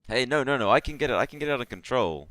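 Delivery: high, loud, hurried protest; panicky hobgoblin. Acted. Hobgoblin begs intruders not to demolish the place, panicky. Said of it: "says the hobgoblin".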